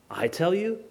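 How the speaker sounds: soft, quick question rising at the end; quietly puzzled query; incredulous echo. Acted. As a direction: echo it back doubtfully. Repeats the claim in disbelief.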